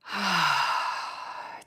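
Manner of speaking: long sighing breath out; audible exhale. Shown as a demonstration. Yoga teacher modelling a breath for students, long sighing exhale. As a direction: let all the breath out in a long sigh.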